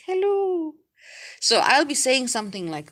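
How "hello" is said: much higher, sing-song hello; talking to a baby on camera; cooing hello to a baby. Retold or imitated. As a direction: coo back at the baby on screen. I'll be like.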